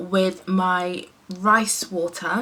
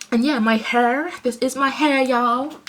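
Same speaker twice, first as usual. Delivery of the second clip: playful put-on American accent, pitched up; 5 semitones higher than usual. Vlogger revealing natural hair to viewers, playful American accent. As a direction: show it off in a mock American drawn-up lilt. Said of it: "doing, like, an American accent".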